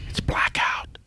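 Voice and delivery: hushed, voiceless whisper; playful confidential whisper; mock-secret whisper. Mock-secret answer about excluded dates.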